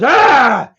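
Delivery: short loud mock shout; retold yell. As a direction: bark it out like Dad. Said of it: "Dad raised his voice".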